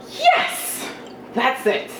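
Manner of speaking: re-enacted very high-pitched reply; reply in a startlingly high tone. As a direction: yelp a sudden squeaky high yes, then drop partway down. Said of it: "in a very high, high frequency of tone".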